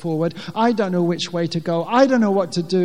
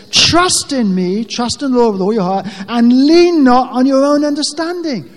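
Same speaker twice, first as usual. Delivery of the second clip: louder, commanding voice of God; a lightly voiced, louder commanding Lord; 5 semitones higher, 9 dB louder than usual. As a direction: speak as God, with authority. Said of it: "And so the Lord says".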